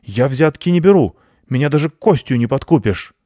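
high, loud indignant refusal; huffy guard dog protest; angry dog. A narrator's reading. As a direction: declare yourself unbribable, offended. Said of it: "рассердился пёс".

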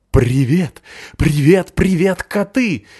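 high, loud, eager big puppy; excited dog voice. Acted. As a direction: call out to the cats, bouncing. Пёс Рокки зовёт котов. (восторженный щенячий голос)

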